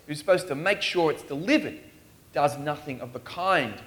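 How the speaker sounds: usual speaking voice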